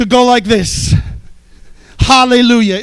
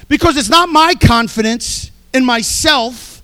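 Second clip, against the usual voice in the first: ordinary delivery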